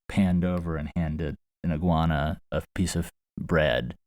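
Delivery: ordinary talk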